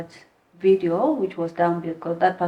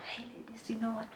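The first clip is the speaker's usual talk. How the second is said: imitating a roommate's whisper; hushed; 4 semitones higher, 16 dB quieter, faster than usual. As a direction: whisper it like a secret heads-up. Roommate quietly breaks news of arrival.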